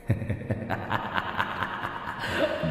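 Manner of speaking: breathy unvoiced ghost laugh; ghostly cackle. Ghost Thụ mocking Biển, breathy cackle. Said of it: "vừa cười".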